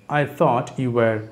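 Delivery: very slow, word by word; slowed-down teacher model; slow drill. Shown as a demonstration. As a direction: stretch each word out for the class. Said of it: "teacher drills phrase slowly".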